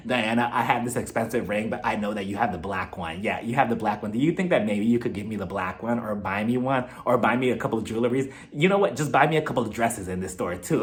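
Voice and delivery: low, soft wheedling plea; begging Erica voice. Retold or imitated. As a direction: coax quietly, a bit greedy. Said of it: "She's like".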